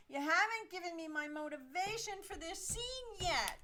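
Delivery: spoiled actress whining to the director; whiny actress. Acted. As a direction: keep whining through every grievance. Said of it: "whines the actress".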